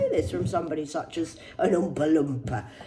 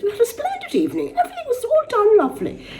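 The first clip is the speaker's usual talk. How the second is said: much higher, laughing, prissy society-lady voice; mock posh lady. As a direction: send up a posh hostess, giggling through it. Mocking Jennifer's posh online post.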